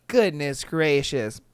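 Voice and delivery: normal talking voice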